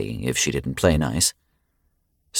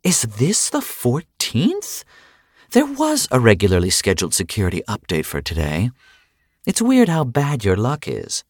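mock horror; 6 semitones higher than usual. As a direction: overplay innocent alarm. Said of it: "in mock horror".

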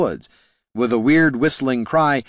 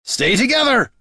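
high, forceful battle shout; roared; 13 semitones higher, 4 dB louder than usual. A narrator's reading. Gorm as the scaly tide rushes them, roared. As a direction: roar the order to the group. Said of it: "roared Gorm".

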